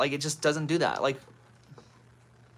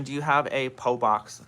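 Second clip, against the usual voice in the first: ordinary talk, nothing marked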